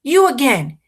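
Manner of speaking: put-on shouted high and loud at a boy in a tree; angry yell. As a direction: bellow him down out of the tree. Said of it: "A man yells".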